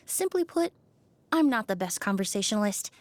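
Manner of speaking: usual speaking voice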